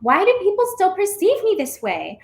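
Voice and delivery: usual speaking voice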